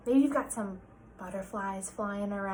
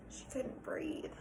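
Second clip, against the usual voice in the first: slow unvoiced whisper; breathless whisper; 5 semitones higher, 14 dB quieter, slower than usual. A narrator's reading. Narrator whispering Sarah's panic to the class. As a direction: gasp it out.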